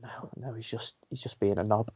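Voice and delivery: hushed, dismissive murmur; quiet aside. Retold or imitated. Coach quietly dismissing the lad showing off the drill, hushed murmur. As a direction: mutter it under your breath.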